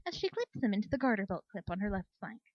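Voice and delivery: ordinary delivery, nothing marked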